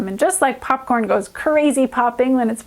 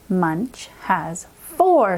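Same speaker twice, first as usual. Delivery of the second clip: ordinary talk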